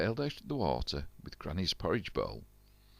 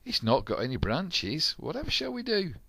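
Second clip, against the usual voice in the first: much higher, louder fretting; high worried wail; 10 semitones higher, 5 dB louder than usual. A narrator's reading. Crew fretting at the branchless tree, high and loud. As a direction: wail the question.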